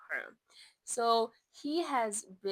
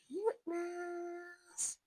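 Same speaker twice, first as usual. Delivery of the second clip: gasp; 9 semitones higher, 3 dB quieter, much slower than usual. Real, not acted. Gasp at cuteness.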